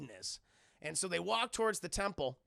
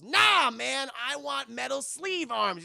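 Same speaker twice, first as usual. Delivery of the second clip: loud, higher-pitched refusal, last words drawn out; defiant protest; 7 semitones higher, 8 dB louder, slower than usual. Retold or imitated. Jax refusing Raiden's pep talk, in loud defiant protest. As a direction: refuse emphatically, voice jumping up.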